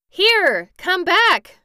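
high, loud alarmed shout; panicked yell to someone getting away; frightened cry. A narrator's reading. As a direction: call after it in fright. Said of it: "cried Tip in a frightened voice".

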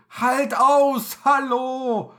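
loud, high cheering call. Acted. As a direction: cheer him on. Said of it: "das Schiffsvolk jubelt".

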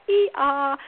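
two-note doorbell chime; doorbell imitation. Shown as a demonstration. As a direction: sing out a child's doorbell sound. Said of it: "sound like a doorbell".

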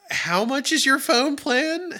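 high, slow, drawn-out disbelieving question; bewildered questioning. Retold or imitated. Saleswoman asks a frozen shopper about his phone plan, bewildered. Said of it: "questioning her reality at that point".

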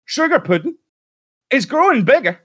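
very high, loud squeaky wife voice; alarmed wife voice. Retold or imitated. Wife alarmed by growing light, high loud squeak. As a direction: shriek it like a panicked housewife. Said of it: "enough to make her go".

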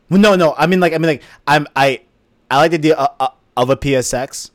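high, loud, flustered objection; emphatic protest. Real, not acted. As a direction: blurt it out, backpedalling loudly. Protesting misunderstanding about PSX.

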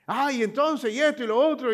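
high, loud, fast, exalted sales pitch; hammed-up thrilled saleswoman insisting; overexcited saleswoman. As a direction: imitate a telemarketer who can't believe you'd say no. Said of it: "exaltada, emocionadísima".

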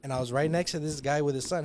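ordinary talk, nothing marked

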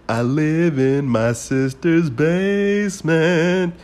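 mock quoting the Wedding Singer; movie quote impression. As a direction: drag it out like a sad movie character. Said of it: "same fucking scene from the Wedding Singer".

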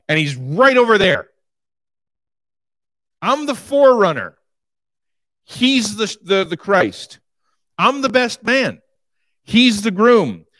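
John the Baptist voiced. Preacher voicing John the Baptist.

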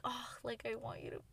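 breathy unvoiced whisper, quick. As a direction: whisper it as a private plea. Quoting own inner plea to AI.

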